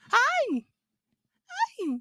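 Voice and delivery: very high squeaky greeting to the dog; high dog-talk hi; pet talk. Real, not acted. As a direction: squeal hi at the puppy.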